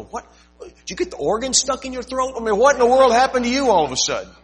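high, loud, fast incredulous cry; mock bewildered. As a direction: gasp at the churchy voice. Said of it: "I wanna go".

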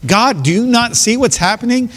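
high, pleading cry of disbelief; desperate plea to God. Retold or imitated. Preacher voicing a believer's plea to God, high and pleading. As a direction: cry out to God as if he can't see it. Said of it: "and you're like".

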